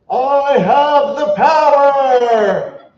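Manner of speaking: high, loud, drawn-out heroic yell; triumphant battle yell. Retold or imitated. Kids heaving croquet mallets overhead, triumphant drawn-out yell. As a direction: bellow it long and high, like a cartoon hero. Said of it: "over our head and yell".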